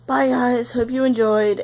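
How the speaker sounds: ordinary talk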